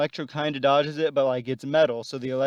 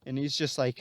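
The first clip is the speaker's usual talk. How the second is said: ordinary delivery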